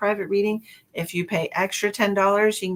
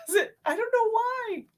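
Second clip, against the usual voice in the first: ordinary talk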